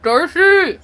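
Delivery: loud call of a name. Retold or imitated. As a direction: shout the name across the room. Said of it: "she's just like".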